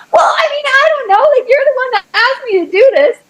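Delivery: high, fast, flustered excuse; flustered protest. Retold or imitated. Caught out by God, high and flustered. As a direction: stammer a defensive reply. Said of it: "And I was like".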